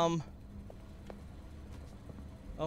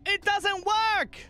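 panicked cry; 10 semitones higher, 3 dB louder, much slower than usual. Real, not acted. Panic at broken exit.

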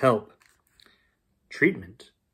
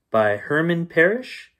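plain reading voice

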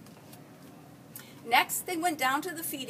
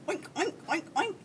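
higher-pitched pig snorts; squealing pig oinks; 5 semitones higher than usual. A narrator's reading. Pigs run to the slop, squealing oinks. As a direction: imitate hungry pigs oinking. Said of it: "came running and squealing".

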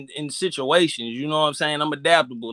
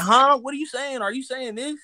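higher-pitched imitation of a baffled listener; mock confused outsider; 6 semitones higher than usual. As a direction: lightly mimic someone who can't follow. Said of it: "You be like".

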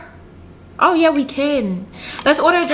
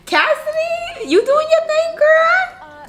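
much higher, sing-song call across the room; slower than usual. Acted. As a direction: call out to her in a high, teasing voice. Calling out to friend Cassidy.

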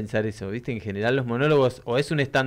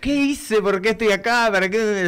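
higher and louder, hammed-up existential despair; mock dramatic lament; 9 semitones higher, 5 dB louder than usual. Recounting her long monologues to her dog, hammed-up dramatic lament. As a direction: play up the big questions as if talking to the dog. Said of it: "Ahora voy diciendo".

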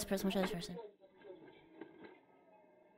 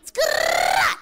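tire screech noise. Pretend. Imitates tire screech for donut.

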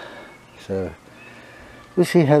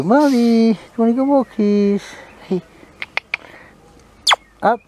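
very high, loud singsong to the dog; excited high pet talk; high dog-calling voice; 11 semitones higher, 5 dB louder than usual. Real, not acted. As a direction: squeal it up to grab the dog's attention.

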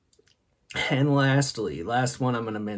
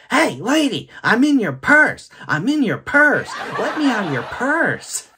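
put-on very high, loud kid voice; imitating little sister; 11 semitones higher, 8 dB louder than usual. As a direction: mimic a child squealing the lines over and over. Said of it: "She was like".

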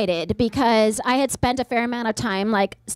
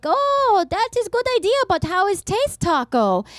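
put-on high, lightly accented aunt asking; a hint of a Persian aunt's accent; Iranian aunt; 7 semitones higher than usual. Aunt visiting from Iran asking about tacos, light accent. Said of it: "she was like".

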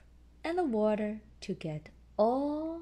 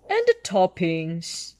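louder jolly dad pig; Daddy Pig voice; 9 dB louder than usual. A narrator's reading. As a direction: announce it like a cheerful father. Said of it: "continues Daddy Pig".

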